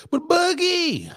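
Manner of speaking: loud, high, stretched hail; big call-out. Real, not acted. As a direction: announce the segment to the cohost. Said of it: "segment call-out".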